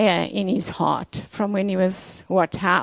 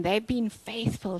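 ordinary delivery

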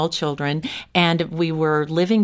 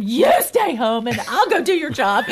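laughing retort; 6 semitones higher than usual. Retold or imitated. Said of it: "I would say to him".

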